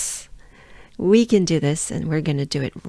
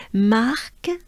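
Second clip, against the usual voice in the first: marque rising at the end, higher; not over; 4 semitones higher than usual. Shown as a demonstration. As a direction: lift marque as if more follows. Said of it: "'cause it's not over".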